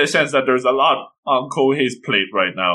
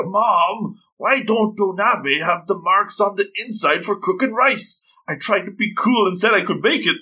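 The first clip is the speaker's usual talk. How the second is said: higher, lightly voiced girl calling her mom; panicky teen girl; 7 semitones higher than usual. Girl on the phone to her mom, worried and higher. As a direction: play the flustered girl who bluffed she could cook.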